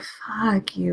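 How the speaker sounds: hissed under the breath, voiceless; whispered curse. Retold or imitated. Mocking Prowl in a retelling, a whispered curse. Retold angry quip at Prowl.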